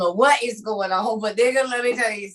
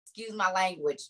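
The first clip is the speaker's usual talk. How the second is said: ordinary talk, nothing marked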